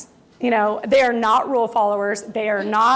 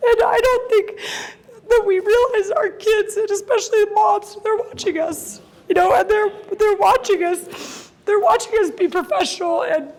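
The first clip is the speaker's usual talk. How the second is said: real tears welling, voice pitched up and breaking; fighting back tears as she speaks; tearful; 8 semitones higher than usual. Real, not acted. Mother telling the audience about her kids, voice breaking with tears. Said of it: "I'm gonna try not to cry".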